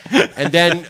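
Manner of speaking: high, loud, laughing through it; giggling at his own pride; real laughter. Real, not acted. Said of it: "laughs through the words".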